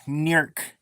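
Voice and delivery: "New York" with a Florida drawl on York, "Nork"; Southern pronunciation. Shown as a demonstration. Showing how Floridians say "New York", drawled. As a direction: say "New York" the local way, vowels run together. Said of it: "that's how they say it down here".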